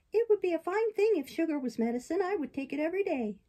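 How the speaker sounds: high little puppet voice; sugar-loving puppet. Acted. Puppet licking his lips after sugar, high-voiced.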